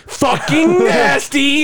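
high, drawn-out disgust. Real, not acted. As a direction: stretch it out, grossed out. Disgust at friend's chip pick.

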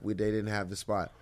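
ordinary talk, nothing marked

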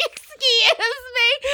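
high, loud and drawn out, laughing; frantic mock excuse. Crying girl pushing past the McDonald's line, high and laughing. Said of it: "I was like".

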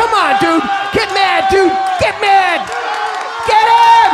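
high, building shouts of encouragement; urgent cheering. Real, not acted. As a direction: yell to fire up your fighter. Cheering on arm wrestler.

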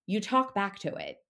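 ordinary delivery

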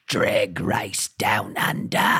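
low, very slow Australian villain; villainous Australian accent. Shown as a demonstration. Podcaster tries the show title, Australian cartoon-villain accent. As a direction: draw it out like an Australian bad guy. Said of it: "you're like the bad guy in the Rescuers".

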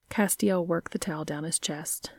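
ordinary delivery, nothing marked

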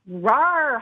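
a loud playful roar; hollered rawr. Acted. Yeti hollering at Monster, loud and playful. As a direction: give a friendly monster holler. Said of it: "hollered Yeti".

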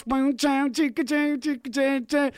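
high sung boom jank guitar noises; mouth guitar riff. Acted. Host playing air guitar, sung guitar riff. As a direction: imitate a twangy guitar with your mouth.

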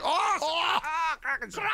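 mock pained yelp as the skin pulls free. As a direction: squeal it like you stood up too fast off a plastic chair. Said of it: "That's like".

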